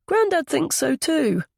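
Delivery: put-on high-pitched child's voice; little boy. A narrator's reading. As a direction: chip in like a small boy. Young boy talks about grandfather.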